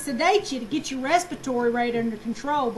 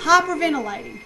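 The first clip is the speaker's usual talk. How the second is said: hysterical gasping. Acted. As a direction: gasp for air like a hysterical patient. Acts out hysterical hyperventilating patient.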